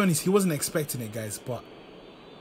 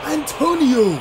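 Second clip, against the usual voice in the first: shocked; 11 semitones higher, 9 dB louder, slower than usual. Real, not acted. Shocked at opponent goal.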